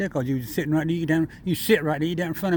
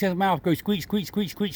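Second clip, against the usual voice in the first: ordinary delivery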